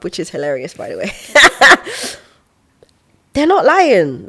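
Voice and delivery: ordinary talk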